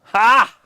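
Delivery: loud sharp ha; triumphant ha. Real, not acted. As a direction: bark out a single laugh. Triumphant laugh.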